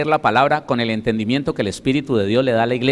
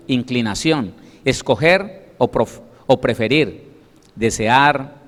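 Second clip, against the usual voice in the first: ordinary delivery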